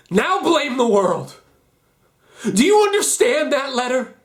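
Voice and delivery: high, loud, accusing challenge; angry accusation. Acted. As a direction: drive the blame home with force. Confronts listener after reading letter.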